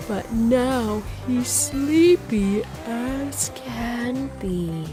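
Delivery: ordinary delivery, nothing marked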